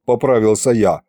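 ordinary delivery

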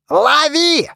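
sudden loud yell; shouting. A narrator's reading. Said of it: "заорал неожиданно Мумий".

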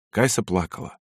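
ordinary delivery

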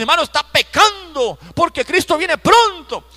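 high, loud imitation of a scandalized believer; hammed-up pious warning, loud and high; mock scolding. Mimics objecting church members.

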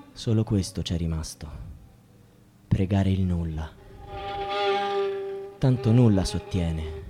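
very low, hushed resignation; hopeless murmur. Acted. Man left praying to nothing, hopeless murmur. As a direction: sink down softly, emptied out.

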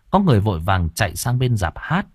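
usual speaking voice

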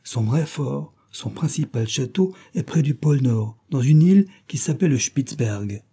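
plain reading voice

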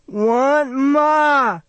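very high, loud pleading wail; frightened child's wail. Acted. Boy restrained by the doctor begs for his mother, frightened wail. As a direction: demand mother like a panicking small boy.

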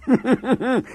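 squeaky high-pitched giggle burst. Real, not acted. Comedian cracking up mid-joke. Teller laughs at own joke.

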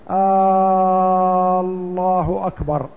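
long held "آ" opening "الله أكبر"; meaning-changing stretch. Shown as a demonstration. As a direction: draw out the first vowel of "الله" very long. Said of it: "بالتمطيط الذي يحيل المعنى". (مد همزة "الله" مدا طويلا)

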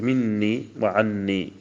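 مني وعني with a slow, held, doubled nun; slow, emphatic مني وعني. Shown as a demonstration. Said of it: "فتقول".

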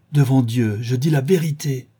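woman crying out an oath; exclaimed. A narrator's reading. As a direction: cry out your oath. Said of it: "s'écria-t-elle".

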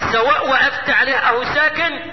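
usual speaking voice

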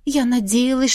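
ordinary delivery

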